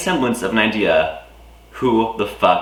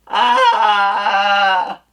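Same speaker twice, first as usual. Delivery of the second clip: high cartoon yelp; 11 semitones higher, 4 dB louder than usual.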